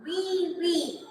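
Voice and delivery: teacher voicing the piglet's cry softly; piglet squeal. Shown as a demonstration. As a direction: squeal gently like a little pig. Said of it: "he cried aloud".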